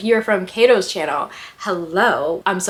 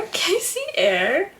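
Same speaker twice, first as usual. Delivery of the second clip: slow and much higher, softer; impressed; 11 semitones higher, 4 dB quieter, slower than usual. Real, not acted. Reacting to a bold romantic line, swooning. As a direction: stretch it out, swooning.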